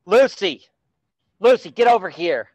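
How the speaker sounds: loud call away from the mic; calling the dog. Real, not acted. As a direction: shout to the dog across the room. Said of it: "Sorry, that was my dog".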